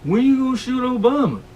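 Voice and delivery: high, loud yell from the crowd; heckler's yell. Retold or imitated. Short heckler in the crowd yells at the speaker, loud and high. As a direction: shout it out from the back of the room. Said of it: "yells out".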